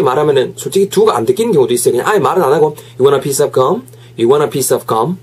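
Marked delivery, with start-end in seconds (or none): none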